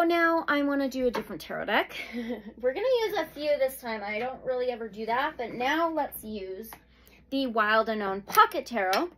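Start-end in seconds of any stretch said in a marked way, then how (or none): none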